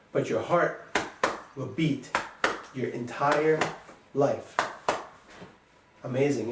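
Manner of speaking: usual speaking voice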